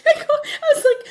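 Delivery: high, loud laugh into the words; real laughter. Real, not acted. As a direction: crack up mid-sentence. Laughing hard.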